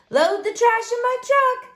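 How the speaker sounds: high-pitched yelled reply; yelling back. A narrator's reading. Lena answers Luke during the library cleanup, yelling back. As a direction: pitch it up and yell back to a helper. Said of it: "Lena yelled back".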